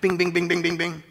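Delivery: high, loud repeated bings; ping it out quickly like a little bell; rapid pinging noise. Shown as a demonstration.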